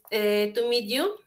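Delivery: ordinary talk, nothing marked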